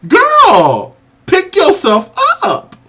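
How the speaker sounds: high, drawn-out shout at the TV; re-enacted yell. Viewer on the couch yelling at a contestant, re-enacted yell. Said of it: "I literally yelled".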